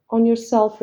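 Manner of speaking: ordinary talk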